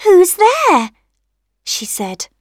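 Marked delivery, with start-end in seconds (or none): 0.0-0.9 high, loud little questioning voice; rosebud calling out. A narrator's reading. Rosebud to tapper on window, high loud question. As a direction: call it out as a small rosebud. Rosebud asks who knocks.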